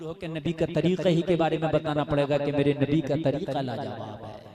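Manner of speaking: loud, driving sermon voice; fiery preaching. Acted. Preacher to jalsa crowd, loud fiery preaching. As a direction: preach it out to the crowd at full volume.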